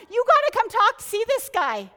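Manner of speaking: high, loud, eager call to the town; a mild mock of an excited villager; excited telling. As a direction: burst out with news you can't hold in. Woman excitedly tells the town.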